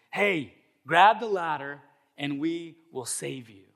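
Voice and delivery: slow, projected trooper announcement; loud, drawn-out opening hail; megaphone call. Acted. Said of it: "gets on the megaphone".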